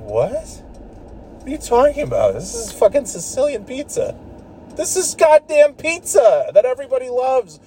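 answering a friend who mocked his Sicilian pizza, outraged and high; indignant outburst. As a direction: defend the Sicilian pizza, incensed. Retelling his outraged reply to friend.

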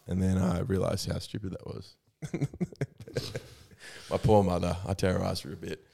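ordinary delivery

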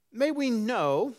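high, slow haughty scholar; lofty, loud academic tone; haughty scholarly. Acted. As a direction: draw it out with scholarly superiority. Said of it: "that real haughty, you know, um, scholarly tone".

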